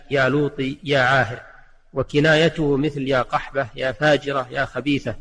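normal talking voice

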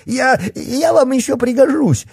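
very high panicked begging; squeaky cry. A narrator's reading. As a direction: squeal for your life, stammering. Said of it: "пискляво закричал полицейский".

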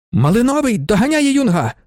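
high-pitched race call; excited spectator. A narrator's reading. Spectator watching the horse catch the leader, excited. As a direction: call the chase high and urgent.

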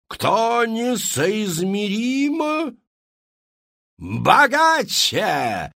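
slow, loud, drawn-out cry; dramatic shout. A narrator's reading. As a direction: build suspense then shout it. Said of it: "выкрикнула она". (медленный громкий драматичный выкрик)

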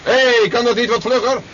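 high, impatient shout across the water; impatient call. Acted. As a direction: hurry someone up from a distance. Calling impatiently to someone coming.

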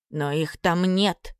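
ordinary delivery

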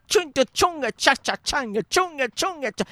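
jerky mouthed cha-cha rhythm, sharp and high; cha-cha beat noises. Retold or imitated. Mocking the dancer's jerky cha-cha steps with mouth noises. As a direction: scat the choppy dance beat.

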